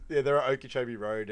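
normal talking voice

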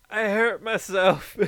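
high, drawn-out hurt whimper; hammed-up poor-me complaint; mock pained whine. Retelling own whine after head hit.

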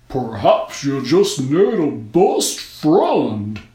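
slow, loud, slurred snowman; hearty slurring snowman voice. A narrator's reading. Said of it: "a slurred but hearty voice".

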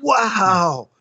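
long, high drawn-out wow; elated, high and falling wow; thrilled whoop. Retold or imitated. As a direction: relive the thrill with a stretched-out cry. Reliving his joy on hearing song.

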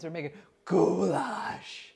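"goulash" stretched out slowly; slow, hammed-up "goulash"; drawn-out goulash. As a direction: drag "goulash" out in mock-grand reply. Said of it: "I want you to say it".

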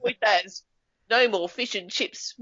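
high-pitched, drawn-out New Zealand accent; Kiwi accent. Shown as a demonstration. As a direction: put on a high, slow Kiwi news voice. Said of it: "it was, it was Kiwi".

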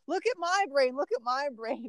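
high-pitched imitation of an eager kid; eager child. Daughter rolling over to mom, imitated high eager plea. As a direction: pipe up high, begging for a turn.

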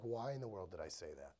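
very low, quiet self-reproach; hushed regret. Retold or imitated. Spouse regretting a correction after the fact, hushed self-reproach. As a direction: drop right down, muttering to yourself.

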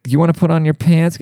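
parent asking. Retold or imitated. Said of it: "they'd be like".

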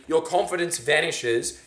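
normal talking voice

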